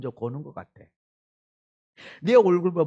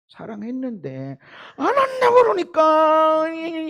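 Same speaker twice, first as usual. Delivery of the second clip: normal talking voice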